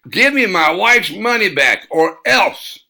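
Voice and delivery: loud, high threatening yell; angry hillbilly yelling a threat; hillbilly shouting. Acted. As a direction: shout the demand at him. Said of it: "The scruffy hillbilly shouted".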